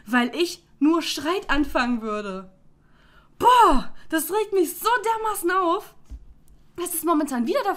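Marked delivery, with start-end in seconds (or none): 3.4-3.9 loud fed-up Boah; exasperated groan. Real, not acted. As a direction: let out the frustration. Exasperated outburst about family.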